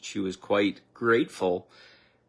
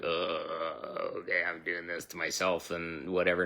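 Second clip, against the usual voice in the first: weary realization; 3 semitones lower, 5 dB quieter than usual. Retold or imitated. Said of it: "It's like".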